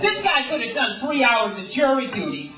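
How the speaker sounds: hammed-up crime show narration; angry TV narrator. Crime show narrator mocking a comedian who missed jury duty, hammed-up indignation. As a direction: narrate it like an irritating, judgmental TV host. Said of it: "angry guy narrating my story".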